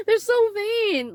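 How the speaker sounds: high, slow words through real laughter; laughing. Real, not acted. Reader laughs about the vain ex, words riding the laugh. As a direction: laugh it out in disbelief.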